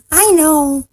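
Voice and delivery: high little speck creature; tiny calling voice. A narrator's reading. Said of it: "called the voice".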